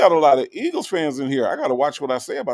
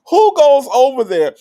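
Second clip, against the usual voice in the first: incredulous outburst; 9 semitones higher, 8 dB louder than usual. Real, not acted. Incredulous about a rival streamer.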